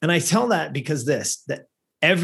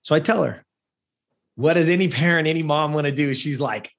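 ordinary talk, nothing marked

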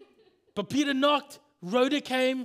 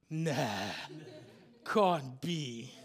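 doubting Thomas; 9 semitones lower, 4 dB quieter, much slower than usual. Acted. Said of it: "and he goes".

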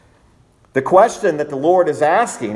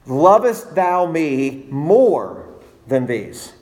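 slow solemn quote; much slower than usual. Acted. Quoting Jesus' question.

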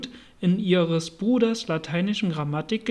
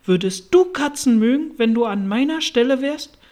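high, loud indignant mouse; furious challenge in a raised mouse voice; screeching, furious; 5 semitones higher, 6 dB louder than usual. A narrator's reading. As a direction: demand it angrily, voice raised. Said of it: "mit kreischender, wütender Stimme".

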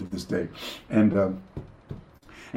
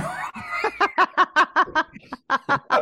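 real laugh. Real, not acted. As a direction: crack up at his own story. Laughing at his own story.

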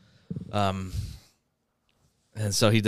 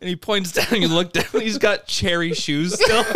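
high, loud, breaking into laughter; laughing while talking; 12 semitones higher, 4 dB louder than usual. Real, not acted. Teller reveals the cherry shoes, laughing hard. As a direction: deliver the punchline while cracking up. Cracking up at own punchline.

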